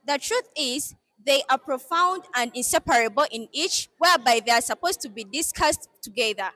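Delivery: formal address to an audience; formal speech. Acted. Head girl opening her speech to viewers, formal address. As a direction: speak like at a podium.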